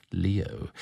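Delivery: ordinary delivery, nothing marked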